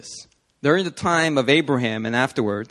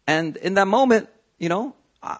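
ordinary talk

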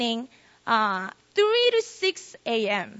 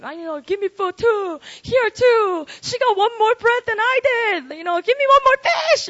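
high, loud whining voice; mock the pushy crowd begging for more; whiny crowd; 7 semitones higher, 4 dB louder than usual. Preacher mimics the crowd demanding more food, high and whiny.

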